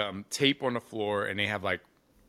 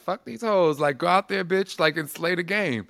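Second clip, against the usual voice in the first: Aisha Francis hyping her up; 7 semitones higher, 4 dB louder than usual. Retold or imitated. Imitating her friend's hype talk before she walks on stage, high and loud. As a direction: play the brash friend who won't let her doubt herself. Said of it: "she would be like".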